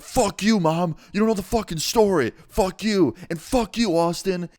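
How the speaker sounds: high-pitched tantrum outburst; mock meltdown. Son cursing out mom and visitor, mock meltdown. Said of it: "starts having a fucking meltdown".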